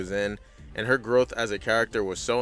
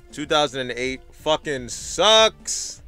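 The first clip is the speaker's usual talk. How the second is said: exasperated; 4 semitones higher, 6 dB louder, slower than usual. Real, not acted. Narrator venting about weak season.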